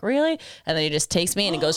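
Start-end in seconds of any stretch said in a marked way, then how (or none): 0.0-0.3 higher incredulous snap; snapping retort. Retold or imitated. Boyfriend snapping back at her, higher incredulous mock retort. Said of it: "kinda snaps and is like".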